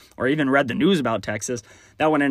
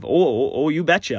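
put-on Minnesota accent. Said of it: "learning a new language of".

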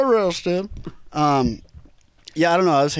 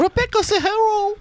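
high squeaky voice. Pretend. Chanting hero with laughter.

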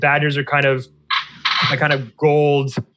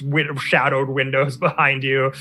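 usual speaking voice

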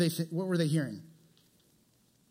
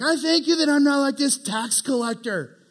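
smug; 7 semitones higher, 4 dB louder than usual. Retold or imitated. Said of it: "And he's like".

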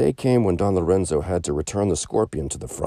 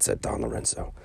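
ordinary delivery

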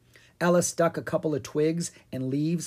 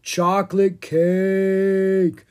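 very slow, loud, higher drawn-out moan; zombie groan; 6 semitones higher, 7 dB louder, much slower than usual. A narrator's reading. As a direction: stretch it out like a hungry zombie. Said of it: "Owen groaned".

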